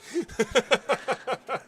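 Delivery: a short high burst of laughter; laughing. Real, not acted. Laughing at cheesecake joke.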